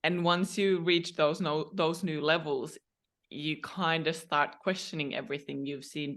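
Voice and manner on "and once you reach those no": ordinary talk, nothing marked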